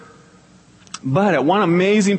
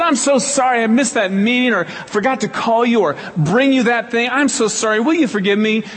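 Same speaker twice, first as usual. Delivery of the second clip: apologizing; 8 semitones higher than usual. Retold or imitated.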